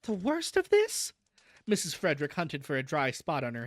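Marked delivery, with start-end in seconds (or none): 0.0-1.1 high, slow plaintive wail; tearful lament. A narrator's reading. Mother with damp handkerchief lamenting, tearful. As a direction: draw it out through mock tears.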